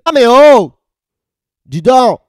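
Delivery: loud indignant burst; mock outrage. Host protesting at an insult, loud mock outrage. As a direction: shout it in hammed-up indignation.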